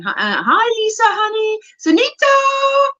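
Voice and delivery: high-pitched run of chat hellos; Raven, chirpy greetings. Retold or imitated. Reading a friend's greetings to the group, high voiced hellos. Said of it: "Raven saying".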